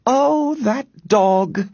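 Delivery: high-pitched motherly exasperation; exasperated. A narrator's reading. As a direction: lament the troublesome dog. Exasperated mother about the dog.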